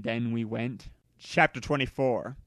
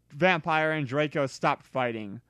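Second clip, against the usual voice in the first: plain reading voice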